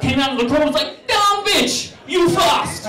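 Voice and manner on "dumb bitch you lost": high, loud mocking jeer; mock Bolt taunt. Usain Bolt mocking the girlfriend, high shouted taunt. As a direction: jeer at the loser.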